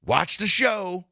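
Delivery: high, slow, drawn-out mock cop taunt; menacing showboating cop. Cop taunting into the kid's camera, menacing. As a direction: imitate a cop playing to the camera. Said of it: "looks down the barrel of the camera and goes".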